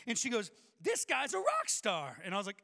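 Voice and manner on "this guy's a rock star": loud, slow, drawn-out boast; excited announcement. Retold or imitated. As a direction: imitate a loud seatmate bragging about a stranger. Loud woman announces the rock star.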